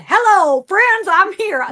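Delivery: high, loud, drawn-out greeting; grand entrance. Pretend. [name] bursting into a haunted room, loud grand. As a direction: sing out your arrival. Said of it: "Me coming in going".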